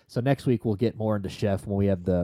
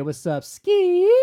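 high, slow, squeaky hello; drawn-out falsetto "What's up, Ski?"; squeaky fan greeting; 6 dB louder, much slower than usual. Pretend. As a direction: call it out like an excited fan in a squeaky voice. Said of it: "you wanna say".

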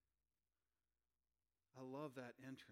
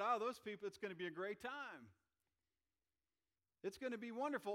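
mock cheerful Jesus; 6 semitones higher, 8 dB louder than usual. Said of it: "do you think he said".

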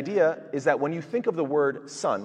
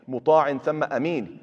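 swift, raised Quran recitation; quick recitation; 3 semitones higher, 6 dB louder, much faster than usual. Shown as a demonstration. Teacher quoting a verse to students, quick recitation. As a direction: rattle the verse off quickly in Arabic.

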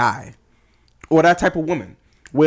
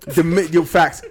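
ordinary talk, nothing marked

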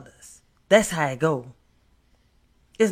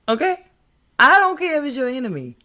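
raised, fast emphatic preaching; loud preaching; 9 semitones higher, 5 dB louder than usual. Real, not acted. Preaching, raising voice.

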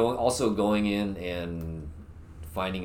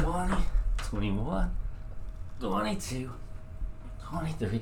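strained counting; 6 semitones higher than usual. Real, not acted. Straining through push-ups.

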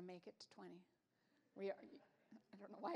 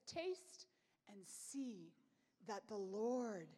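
slow, hushed reading of the verse, fading to a whisper; reverent scripture quote; 4 semitones lower, much slower than usual. Acted. Preacher closing the olive story with the psalm, hushed.

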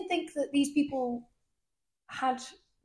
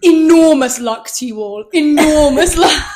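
slow, much louder grand send-off; theatrical host; 3 semitones higher, 14 dB louder, slower than usual. Retold or imitated. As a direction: boom the good wishes grandly. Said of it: "she goes".

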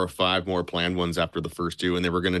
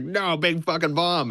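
much higher, slow, pleading insistence; exasperated engineer; 10 semitones higher than usual. Retold or imitated. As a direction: plead with the disbelieving boss, slowly. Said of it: "And he was like".

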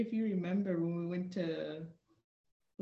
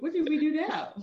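higher, louder, laughing through it; laughing exasperation; 8 semitones higher, 5 dB louder than usual. Retold or imitated. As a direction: groan the question while laughing. Recruits fearing punishment again.

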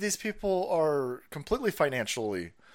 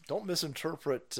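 ordinary talk, nothing marked

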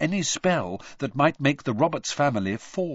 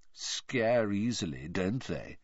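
softer, slow lazy taunt; Malfoy taunting the scared crowd, soft and slow; lazily; 3 semitones lower, 6 dB quieter, slower than usual. A narrator's reading. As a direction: toss it off bored and amused. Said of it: "he said lazily".

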